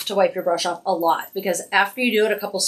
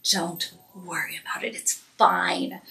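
reassuring; 4 dB quieter, slower than usual. Real, not acted. Reassuring viewers about uneven blending.

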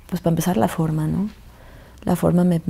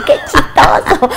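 put-on slow, louder, laughing through the words like a small girl; laughing child; 7 semitones higher, 6 dB louder, slower than usual. Mother recalling her daughter laughing at the funny surname. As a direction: imitate the daughter's amused laugh. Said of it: "se reía por el apellido".

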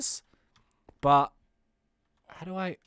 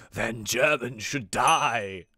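low, very slow sentence of doom; drawn-out low villain voice; menacing evoker villain; 6 semitones lower, much slower than usual. Acted. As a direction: pronounce the threat like an evil boss.

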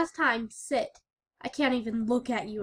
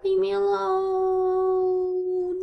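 very slow drawn-out plea; 7 semitones higher, much slower than usual. Acted. Slower repeat.

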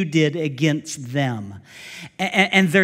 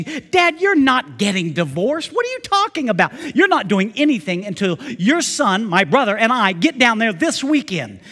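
loud, higher, scolding daughter; bossy daughter; 5 semitones higher, 6 dB louder than usual. Retold or imitated. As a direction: lay down the law to Dad.

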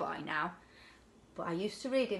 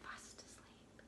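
whispered fast asleep; 26 dB quieter, slower than usual. A narrator's reading. Ending the bedtime story, a soft whisper. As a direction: whisper it very softly.

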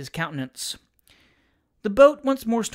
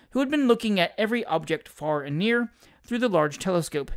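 ordinary delivery

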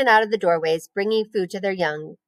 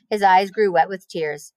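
plain reading voice

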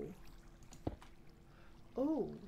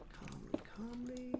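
quick repeated whisper to self; soft self-soothing whisper; whispered calmly; 4 semitones higher, 5 dB quieter than usual. Real, not acted. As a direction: hush it out twice, steadying yourself. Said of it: "I said calmly".

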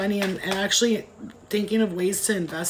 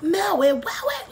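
much higher, slow, giddy stoner exclaim; Dave Chappelle excited; 10 semitones higher, slower than usual. Retold or imitated. Retelling the movie hospital scene, high and drawn out. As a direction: stretch it out like a thrilled stoner. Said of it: "he's like".

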